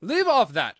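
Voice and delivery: loud, high royal screech; irritated queen yelling; screamed. A narrator's reading. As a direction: scream at them to stop. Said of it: "screamed the Queen".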